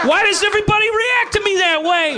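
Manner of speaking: high, exasperated plaintive cry; thick-accented boxer. Retold or imitated. Hurt boxer asking the laughing bar crowd, high exasperated wail. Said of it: "really thick, uh, accent".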